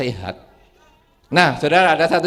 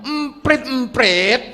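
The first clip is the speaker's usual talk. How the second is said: irritated son; 8 semitones higher, much slower than usual. Retold or imitated. Son snapping at his father.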